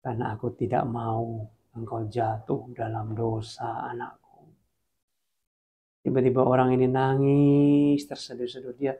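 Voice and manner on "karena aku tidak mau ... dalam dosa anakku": low, soft voice of God; tender father to child, hushed; quietly reassuring, low; God's voice. Acted. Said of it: "Tuhan jawab gini".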